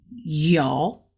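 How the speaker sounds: yo in a low third tone, dipping; Mandarin yo. Shown as a demonstration. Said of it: "can be said as".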